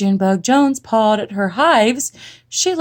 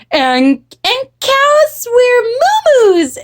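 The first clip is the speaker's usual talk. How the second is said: put-on high, loud, stretched-out child voice; little sister; 4 dB louder, slower than usual. A narrator's reading. Jujubee topping her sister, high singsong. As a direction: add it in a girlish singsong. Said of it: "added Jujubee".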